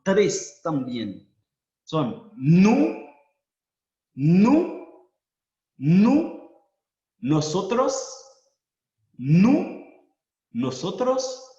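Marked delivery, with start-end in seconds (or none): 1.8-9.8 "nous" loud with a rise, noo; Creole pronunciation. Shown as a demonstration. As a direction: call out "nous" rising. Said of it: "pronombres plurales en criollo". ("nous" fuerte y ascendente, como nu)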